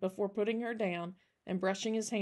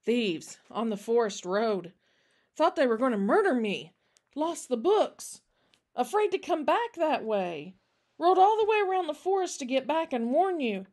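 shaken, words barely getting out; higher, louder, in distressed bursts; overcome with emotion; 5 semitones higher, 6 dB louder than usual. Acted. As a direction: blurt out the terror of a narrow escape. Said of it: "scarcely get the words out as emotion overcame him".

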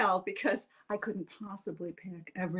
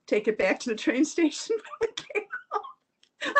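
laughing; 10 semitones higher, 3 dB louder than usual. Real, not acted. Laughs at end of story.

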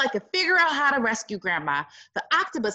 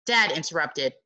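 usual speaking voice

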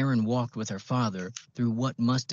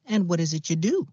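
high, louder, folksy Midwestern greeting; a chirpy old Midwestern accent, pitched up; old-fashioned Midwestern accent; 9 semitones higher, 4 dB louder than usual. A narrator's reading. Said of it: "old-fashioned Midwestern accent".

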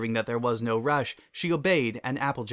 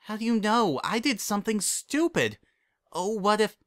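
higher, rising worry; anxious panic; 10 semitones higher than usual. Acted. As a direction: fret breathlessly. Twilight panicking before Celestia.

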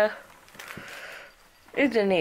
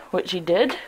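normal talking voice

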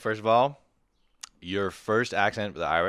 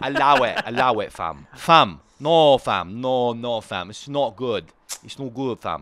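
put-on loud, raised London roadman accent; playful protesting roadman; British roadman accent; 3 semitones higher, 6 dB louder, slower than usual. Co-hosts riffing on slang, British roadman accent. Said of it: "Allow it's better".

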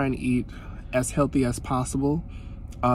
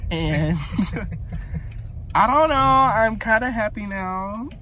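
giddy and happy; 7 semitones higher, slower than usual. Real, not acted. Vlogger squeals to viewers about her Wingstop haul. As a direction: let the happiness bubble over.

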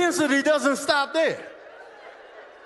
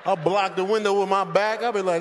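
usual speaking voice

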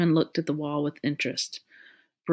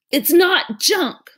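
growled; 12 semitones higher, 8 dB louder, much slower than usual. A narrator's reading. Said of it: "Mallory growled".

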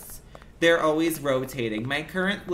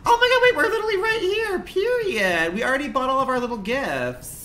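very high, loud excited squeal; 11 semitones higher, 5 dB louder than usual. Real, not acted. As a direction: squeal it out with delight. Sudden excited squeal at game.

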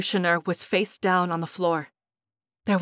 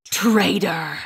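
through gritted teeth. Acted. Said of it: "said through his teeth".

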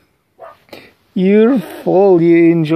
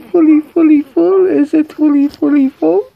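put-on singsong baby talk; 11 semitones higher, 6 dB louder, faster than usual.

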